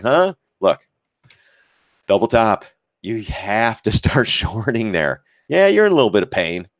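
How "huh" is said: mock confusion. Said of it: "Everyone's like".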